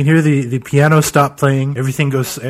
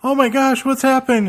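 panicked mourner; 13 semitones higher than usual. Acted.

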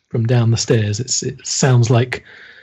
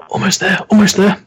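Henri straining; 9 semitones higher, faster than usual. Acted. Henri fumbling keys at lock.

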